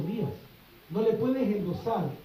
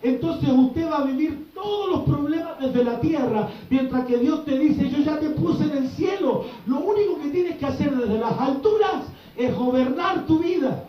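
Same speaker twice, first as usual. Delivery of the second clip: louder, driving sermon build to a climax; preaching at full force; 5 semitones higher, 4 dB louder than usual. Acted. Preacher to congregation about living from heaven, fervent preaching.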